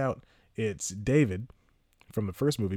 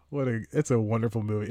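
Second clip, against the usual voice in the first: usual speaking voice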